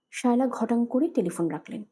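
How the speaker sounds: ordinary delivery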